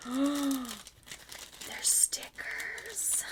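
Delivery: gasp, then a breathless whisper; awed whisper. Real, not acted. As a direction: whisper it in stunned delight. Gasps on discovering stickers.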